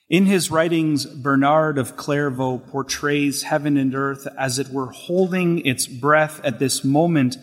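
ordinary delivery

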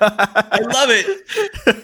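drawn out, high, through real laughter; cracking up while saying it; laughing. Real, not acted. Host cracking up at the guest's accent joke, laughing.

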